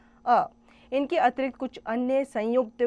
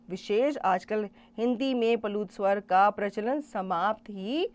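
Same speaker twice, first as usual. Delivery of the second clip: ordinary talk, nothing marked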